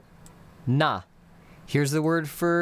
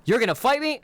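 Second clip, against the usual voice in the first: shoots up high and louder, disbelieving; startled challenge; 9 semitones higher, 3 dB louder than usual. Retold or imitated. Stranger in a bar mishearing, high startled question. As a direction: hear it as an offended, rising question. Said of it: "They're gonna hear".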